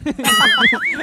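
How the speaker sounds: loud squealing laugh; high shriek laugh. Real, not acted. Laughing at joke.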